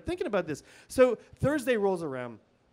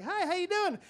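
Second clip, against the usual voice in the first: put-on much higher, friendly old lady greeting; sweet old lady; 11 semitones higher than usual. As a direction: chirp it like a cheery neighbour. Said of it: "old lady ... smiles at me like".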